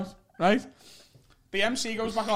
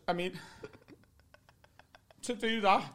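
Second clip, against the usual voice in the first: ordinary talk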